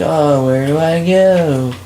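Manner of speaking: high, slow wail of a lost player; mock helplessness. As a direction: play exaggeratedly lost, stretching the words.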